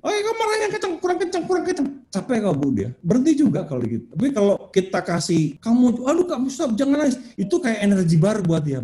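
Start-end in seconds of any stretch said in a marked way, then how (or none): 0.0-1.9 very high-pitched acted goading; mock urging, voice way up. Demonstrating goading child to rage harder. 5.6-7.2 high mock parental pleading to stop; acted exasperated telling-off, high; flustered parent. Acting a parent stopping the crying.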